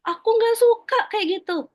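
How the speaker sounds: put-on high, loud protest of a child; acted child's outburst, pitched right up; upset child. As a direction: complain like an upset kid. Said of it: "Jadi kalau dia, dia bilang".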